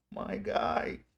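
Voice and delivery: ordinary delivery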